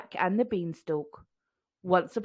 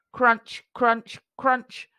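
crunching sound; 2 semitones higher, 5 dB louder, much slower than usual. A narrator's reading. Eating the egg.

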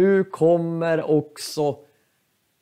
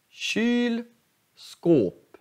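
Kylskåp split in two, both vowels long; slow teaching Kylskåp with stress on both halves; two stressed syllables; 8 semitones higher than usual. Shown as a demonstration. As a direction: stretch Kyl high, then skåp falling. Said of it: "Det är två betonade stavelser".

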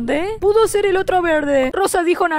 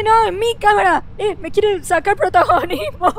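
ordinary delivery

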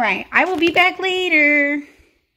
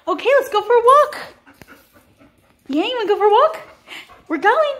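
high, loud, eager voice to the dogs; 8 semitones higher, 4 dB louder, faster than usual. Real, not acted. Dog sitter hyping up the dogs for a walk, high and excited.

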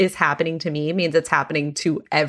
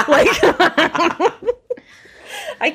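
high, loud burst of laughter; laughing hard mid-sentence; 11 semitones higher, 4 dB louder than usual. Real, not acted. Laughing at own quote.

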